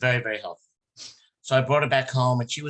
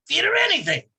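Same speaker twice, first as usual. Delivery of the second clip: high, loud punchline; mock doctor's blunt shout; 12 semitones higher, 5 dB louder, slower than usual. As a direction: bark it out for the laugh. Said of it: "He goes".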